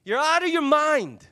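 drawn-out incredulous scoff; scoffing disbelief. Acted. People at the prayer meeting dismissing the servant girl, scoffing.

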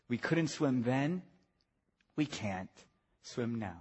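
very soft, low, with long pauses; hushed solemn preaching. Real, not acted. Preacher quietly closing the swimming lesson, hushed. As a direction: bring it right down to a grave hush.

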